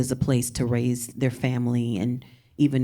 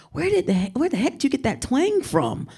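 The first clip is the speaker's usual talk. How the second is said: incredulous; 12 semitones higher than usual. Retold or imitated. Asking a local where the twang came from, high and incredulous. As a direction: blurt it out in disbelief. She is amazed at someone's twang.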